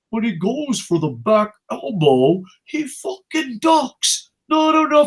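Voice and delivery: high, drawn-out mocking imitation; hammed-up gushing, stretched and rising high; mock documentary fan.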